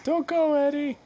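desperate plea. Pretend. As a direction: beg him not to leave. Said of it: "your Wilson".

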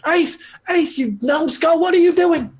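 very high-pitched scolding character voice; scolding Stiffy. Acted. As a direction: call out in a high, exasperated squawk. Game master voices Stiffy running up yelling.

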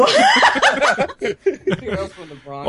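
hammed-up New York tough guy; mock Bronx accent. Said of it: "they all sound like they're from the Bronx".

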